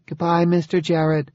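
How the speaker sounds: put-on high, small little-girl voice; a shy child greeting a stranger; small, uncertain child. A narrator's reading. Zoe, small child, says goodbye.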